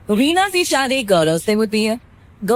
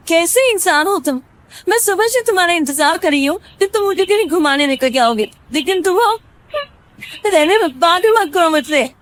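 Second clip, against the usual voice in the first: higher, fast scolding wife; 7 semitones higher, faster than usual. Acted. Reena scolds Gaurav for not taking her out, higher and fast. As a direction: pour out the complaint.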